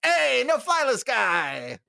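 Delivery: high, drawn-out jovial hail; calling out. Retold or imitated. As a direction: holler it slowly as a teasing greeting. Screener hails him across area.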